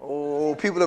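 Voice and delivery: ordinary talk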